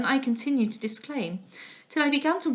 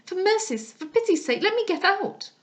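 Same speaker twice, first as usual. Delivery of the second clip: much higher, begging for mercy; desperate plea; 9 semitones higher, 3 dB louder than usual. Acted. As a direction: plead with rising panic. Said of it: "cried I".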